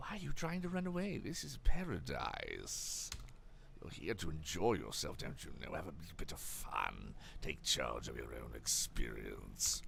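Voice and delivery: very low, slow, soft villain voice; spooky ocean voice. Acted. The ocean tempts the frightened player to stay, spooky low voice. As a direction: coax them in a deep, hushed, sinister croon. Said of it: "that voice again".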